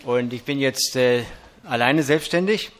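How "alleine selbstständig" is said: usual speaking voice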